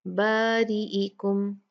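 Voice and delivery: बारीइकुम drawn out slowly with a thin light alif; slow thin recitation. Shown as a demonstration. Teacher shows students the thin alif. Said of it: "इसको बा नहीं पढ़ेंगे".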